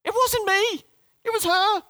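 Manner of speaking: high squeaky blame-shifting Adam; funny voice. Acted. Preacher mimics Adam blaming his wife, funny high voice. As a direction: squeal the excuse in a silly falsetto. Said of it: "said it in a funny voice".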